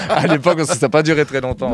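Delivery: bursts out laughing then talks through it; laughing at the admission about the grant; real laugh. Real, not acted. Guest laughing as he admits the fees reason.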